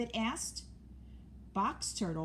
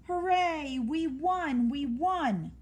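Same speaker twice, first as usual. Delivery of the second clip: cheering; 7 semitones higher, 4 dB louder than usual. A narrator's reading. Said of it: "The other team cheered".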